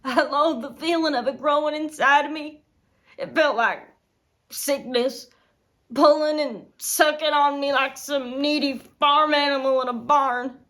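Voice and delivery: loud, intense disgust; loathing. Acted. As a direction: spit out the loathing at high volume. Disgusted memory of pregnancy.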